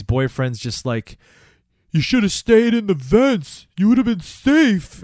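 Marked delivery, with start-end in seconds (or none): 1.9-5.0 high, slow, whiny mock lament; hammed-up high-pitched reproach, louder and drawn out; mock scolding boyfriend. Host voices Susie's boyfriend, high mock scolding.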